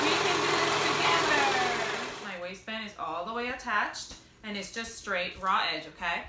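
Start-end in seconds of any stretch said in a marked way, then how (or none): 0.0-2.0 slow, higher and louder, like a cheerleader; rallying cheer. Acted. Vlogger rallying viewers before zippers, slow hammed-up cheer. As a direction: pump up the viewers, drawn out.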